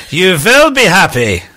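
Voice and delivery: high, loud, hammed-up German Bond-villain accent; Klaus Schwab German accent. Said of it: "I was putting on the accent a bit".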